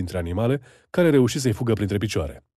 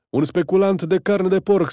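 high, loud outraged cry; shouting; 8 semitones higher, 4 dB louder than usual. A narrator's reading. Neighbour denouncing the meat speculator, high loud shout. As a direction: shout the accusation to the whole street. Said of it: "strigă unul".

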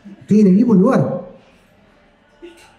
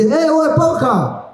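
high slow calling out "¡Eh, Bob Esponja!"; excited call across the square; 9 semitones higher, much slower than usual. Retold or imitated. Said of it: "No, perdón, me confundí".

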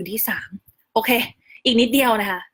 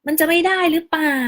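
higher-pitched doubtful question; imitated worry; 7 semitones higher, 4 dB louder than usual. People worried the baby's name won't be allowed, higher worried tone. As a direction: voice other people's mild concern. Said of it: "กังวลแทนว่า".

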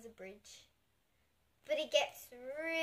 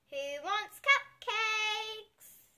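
sing-song offer; 7 semitones higher, much slower than usual. A narrator's reading. Mum calling children to treats.

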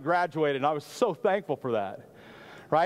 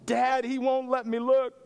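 put-on higher, whiny kid voice on the verge of tears; upset little boy; 6 semitones higher than usual. Boy running to dad after clerk refuses, whiny and higher.

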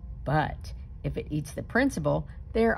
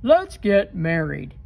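monster proposing; 7 dB louder than usual. A narrator's reading. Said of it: "the monster says".

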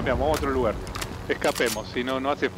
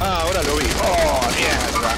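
startled curse; 5 dB louder than usual. Real, not acted. Sudden enemy spotted.

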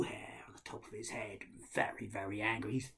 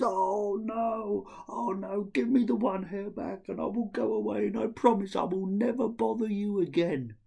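loud, higher whining plea; pleading ghoul; 10 semitones higher, 7 dB louder than usual. Acted. Gadar the Ghoul begging Ahmed, loud whiny plea. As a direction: beg to get the hair back.

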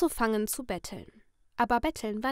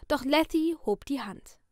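usual speaking voice

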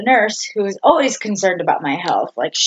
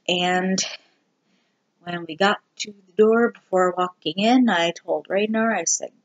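ordinary talk, nothing marked

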